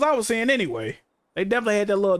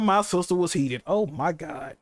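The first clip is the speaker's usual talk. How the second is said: usual speaking voice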